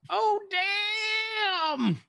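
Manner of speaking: very high, drawn-out comic exclamation; hammed-up, high-pitched mock astonishment; Martin Lawrence. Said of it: "do a Martin Lawrence".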